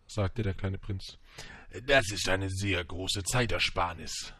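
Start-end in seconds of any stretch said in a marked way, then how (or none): 1.9-4.3 slow, put-on salesman voice; slow storybook merchant; merchant making his pitch. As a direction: draw out the sales claim. Merchant sells his pills.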